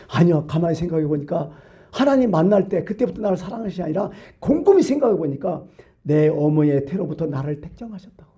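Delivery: usual speaking voice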